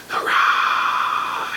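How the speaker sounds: whispered, hissing cheer; hissing basilisk. A narrator's reading. Basilisk hissing a cheer at the birthday. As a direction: hiss it like a serpent. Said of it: "hissed the basilisk too".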